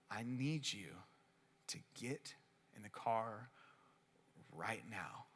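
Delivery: slow and grave, like a father; stern dad voice. Retold or imitated. Boyfriend quietly ordering girlfriend into the car, low stern whisper. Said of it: "dad voice on her".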